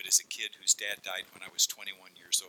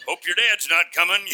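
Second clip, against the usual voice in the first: mocking schoolkid; 9 semitones higher, 12 dB louder than usual. Schoolkids taunting a preacher's child.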